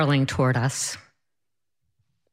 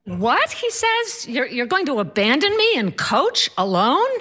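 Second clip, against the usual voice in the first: high-pitched incredulous husband protest; mock outraged whine, rising into a question; indignant disbelief; 6 semitones higher than usual. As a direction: voice shooting up in protest. Husband protests being left behind.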